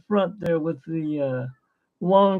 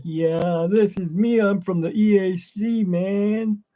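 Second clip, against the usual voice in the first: higher-pitched cool dude turtle; 6 semitones higher, slower than usual. Retold or imitated. Turtle introducing himself as from the E A C, higher dude voice. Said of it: "and it's like".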